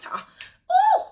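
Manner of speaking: falsetto ooh, very high; high singing ooh. Acted. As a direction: squeal it like a hype ad-lib. Ad-lib while singing.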